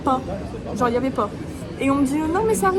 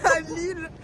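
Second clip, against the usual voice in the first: crying shout; 4 semitones higher, 4 dB louder than usual. Pretend. Dramatic vlog intro, cry shout.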